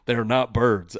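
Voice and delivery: loud mock-exasperated correction; acted-out scolding of an audience member; retold indignant rant. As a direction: argue loudly, as if setting a heckler straight. Retold comeback to a heckler.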